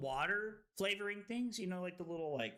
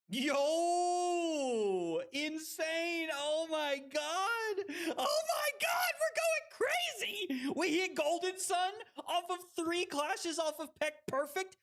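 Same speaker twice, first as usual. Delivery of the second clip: high-pitched, ecstatic streamer celebration; real hype shouting; 9 semitones higher, slower than usual. Real, not acted. Streamer celebrates a wild win, ecstatic shouting. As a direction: yell it out, overwhelmed with excitement.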